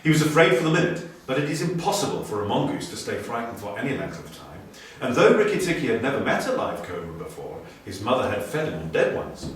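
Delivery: plain reading voice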